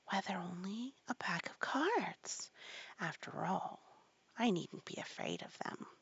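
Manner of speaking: soft, private murmur; aside to herself. A narrator's reading. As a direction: say it quietly to yourself. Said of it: "but she added to herself".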